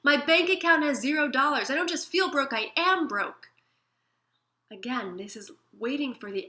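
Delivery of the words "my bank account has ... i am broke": exasperated objection. Retold or imitated. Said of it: "and you're like".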